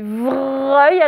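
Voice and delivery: "vreuil" with a long, stressed R; every sound heard. Shown as a demonstration. Teacher making pupils hear every sound, drawn-out "vreuil". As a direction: stretch "vreuil" so each sound comes out. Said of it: "écoutez bien tous les sons".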